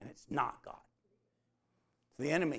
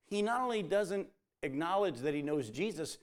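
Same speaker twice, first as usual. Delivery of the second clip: ordinary delivery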